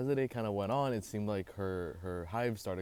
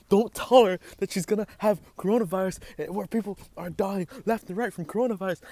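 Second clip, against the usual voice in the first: loud, high exasperated rant; indignant rant; 9 semitones higher, 8 dB louder than usual. Real, not acted. Ranting to viewers about mom scaring her sister, loud and high. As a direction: vent it high and loud at the camera. Ranting at mom's scary words.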